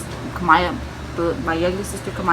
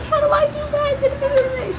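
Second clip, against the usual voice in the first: very high, drawn-out mock wail; flustered wail; 3 dB louder, much slower than usual. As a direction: imitate someone panicking and helpless. Retold flustered reply to urgent orders.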